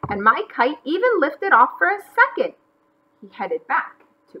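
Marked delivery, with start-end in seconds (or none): 0.1-2.5 higher, louder, excited lilt; happy boasting. A narrator's reading. McGreely proud of his kite, happy boasting. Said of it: "wheezed happily".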